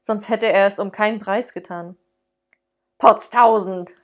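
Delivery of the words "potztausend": louder surprised oath; startled exclamation. Acted. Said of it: "rief Fred".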